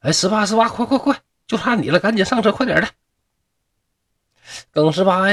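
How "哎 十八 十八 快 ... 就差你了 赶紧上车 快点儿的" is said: much higher, hurried calling. A narrator's reading. Cart escort hurries Geng Shiba aboard, high urgent calling. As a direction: urge him onto the cart, calling up high. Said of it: "就赶紧喊".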